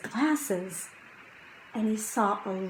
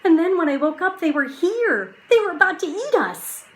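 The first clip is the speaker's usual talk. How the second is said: higher, louder, rushing at the end; excited boy; 9 semitones higher, 6 dB louder, faster than usual. Acted. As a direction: blurt out the danger eagerly.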